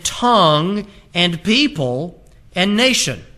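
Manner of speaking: slow, weighty preacher's declamation; forceful pulpit reading; emphatic scripture reading. Acted. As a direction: proclaim the verse phrase by phrase, drawing out key words.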